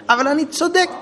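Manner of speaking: put-on a child talking back to his father, high and whiny; mock child protest. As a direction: play the indignant child insisting he's right.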